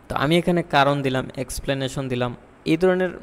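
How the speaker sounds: usual speaking voice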